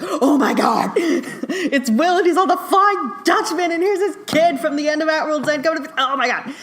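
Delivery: high, loud, laughing fangirl squeal; excited gushing. Retold or imitated. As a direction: gush over it like a thrilled fan. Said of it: "I was like".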